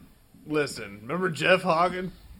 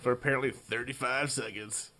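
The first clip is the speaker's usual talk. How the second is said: normal talking voice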